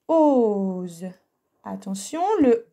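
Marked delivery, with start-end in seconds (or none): 0.1-1.1 "Ose" drawn out, loud ooo falling into ze; stretched-out dictation. Shown as a demonstration. Teacher dictating a syllable word. (« Ose » dicté : oooo-ze, bien étiré)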